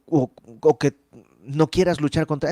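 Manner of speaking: ordinary talk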